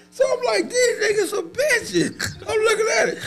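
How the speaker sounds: words pushed out through high laughter; laughing hard. Real, not acted. Telling friends the fight story, laughing hard. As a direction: crack up while telling it.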